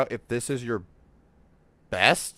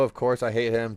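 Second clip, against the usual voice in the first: ordinary talk, nothing marked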